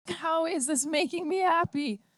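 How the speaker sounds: high, anguished cry; mother screaming in despair; sudden scream. A narrator's reading. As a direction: shriek it out of grief. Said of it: "Marilyn screamed suddenly".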